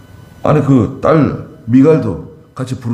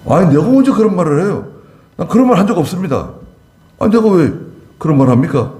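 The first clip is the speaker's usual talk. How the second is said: retold protest, voice jumping higher; indignant denial; 8 semitones higher than usual. Pastor denying the rumour to the colleague, indignant higher protest. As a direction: act out a pastor insisting he never said it.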